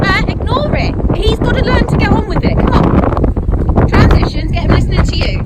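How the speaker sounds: loud coaching calls across the arena; brisk projected riding commands. Real, not acted. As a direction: call out to the rider, firm and brisk. Coaching a rider in warm-up.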